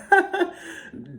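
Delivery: high-pitched burst of laughter. Real, not acted. Laugh alone.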